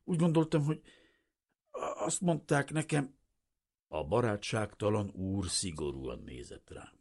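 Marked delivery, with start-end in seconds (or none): 0.0-3.2 high, faltering explanation; stammering. A narrator's reading. Refugee explaining himself to the stern official, stammering. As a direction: stumble over the words, pitched high.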